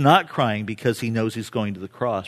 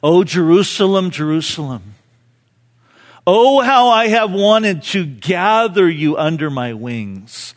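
loud, raised lament; crying out; 5 semitones higher, 6 dB louder than usual. Acted. Jesus overlooking Jerusalem, crying out in lament. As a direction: cry out in anguish over a city. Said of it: "he would cry yet again these words".

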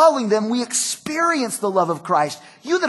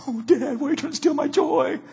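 higher, softer whining protest; whiny kid; 5 semitones higher, 4 dB quieter than usual. Retold or imitated. Son to dad after no more candy, higher mock whine.

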